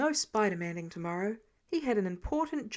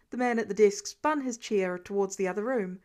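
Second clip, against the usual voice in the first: usual speaking voice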